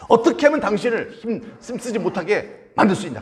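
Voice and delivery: high, insistent nagging demand; acted pestering. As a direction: nag him for the secret. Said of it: "막 때려 쓰는 거예요".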